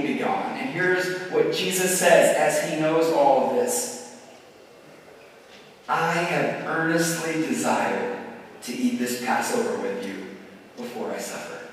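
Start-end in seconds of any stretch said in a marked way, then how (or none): 5.9-11.6 tender quote. Acted. Jesus quoted tenderly in sermon.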